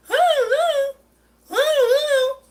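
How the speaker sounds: loud, high put-on call; mocking store staff. Customer mimicking Menards staff on the phone, mocking. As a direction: mimic useless staff with a high, drawn-out hoot. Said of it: "Menards is doing the usual".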